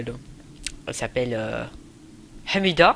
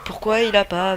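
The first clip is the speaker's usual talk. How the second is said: frustrated outburst; 6 semitones higher, 3 dB louder than usual. Real, not acted. Frustrated at the game.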